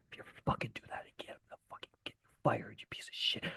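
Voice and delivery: joking whispered threat from the driver buddy; mock pissed-off threat. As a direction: hiss it like a coworker pretending to be furious. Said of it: "like he was super pissed off at me".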